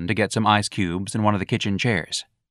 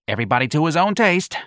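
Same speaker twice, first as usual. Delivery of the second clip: usual speaking voice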